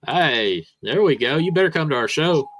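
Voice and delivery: ordinary delivery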